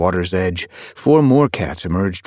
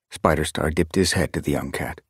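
plain reading voice

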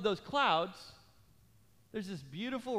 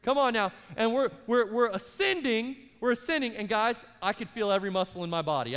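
ordinary talk

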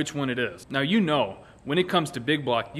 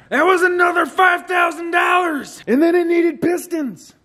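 mock outrage shout; 11 semitones higher, 5 dB louder than usual. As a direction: shout way up high and loud. Outraged at price.

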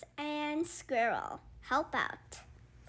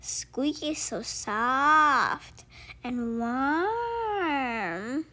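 tender slow coo; much slower than usual. Acted. Squirrel cooing over the sleeping creature, slowly. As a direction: linger over each word lovingly.

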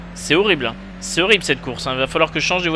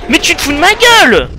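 even higher furious shout; raging gamer; 12 semitones higher, 9 dB louder than usual. Real, not acted. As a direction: scream it again, louder in pitch. Repeats outraged line.

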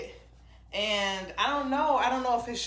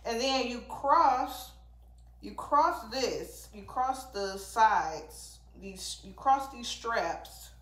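slow; 2 semitones higher, much slower than usual. Real, not acted. Explaining swimsuit straps slowly.

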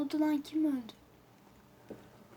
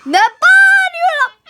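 slow, high, very loud question; loud drawn-out complaint; startled yell; 12 semitones higher, 9 dB louder, much slower than usual. Real, not acted. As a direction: bellow it stretched out in alarm. Reacting to game's scream.

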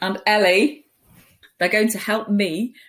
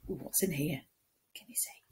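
tiny breathy whisper; hushed peek-inside whisper; 4 semitones lower, 16 dB quieter, much faster than usual. Acted. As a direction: whisper it like a secret. Teasing suspense for children.

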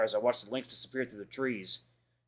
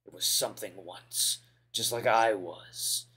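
mysterious voice; 2 semitones lower, slower than usual. Acted. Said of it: "I can't do that voice right now".

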